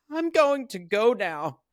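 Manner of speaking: high, loud imitation of Wendy; high mock Wendy. Wendy telling her husband she is leaving, high mock voice. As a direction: squeak it out like a timid wife. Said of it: "And she's like".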